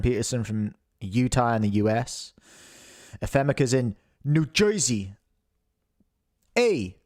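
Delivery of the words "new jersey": "Joisey"-style New Jersey vowels; New Jersey accent. Acted. Greeting a viewer from there, New Jersey accent. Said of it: "is that a New Jersey accent".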